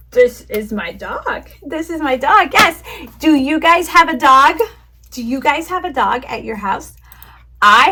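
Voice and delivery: bright, high, loud kindergarten teacher voice; teacher to young kids. Shown as a demonstration. Teaching demo to imaginary class.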